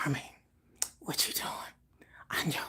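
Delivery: nearly inaudible sleepy mumble. Retold or imitated. Vlogger imitating the idol dozing on stream. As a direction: barely breathe it out like he's half asleep. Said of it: "my other impersonation".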